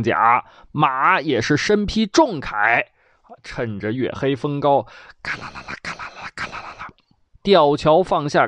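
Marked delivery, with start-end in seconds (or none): 5.2-6.9 soft whispered rattling clatter, fast; creaking chain noise of a drawbridge lowering; drawbridge rattle. Acted. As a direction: imitate chains unwinding under your breath. Drawbridge sound effect.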